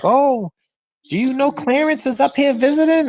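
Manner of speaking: lightly voiced grandma, eager with news; surprised grandma. Grandmother voiced in retold story.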